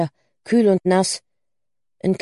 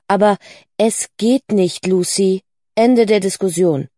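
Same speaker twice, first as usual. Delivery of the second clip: firm refusal; 4 dB louder than usual. Acted. Mother ending the discussion with Lucy, firm and emphatic. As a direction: shut the argument down firmly.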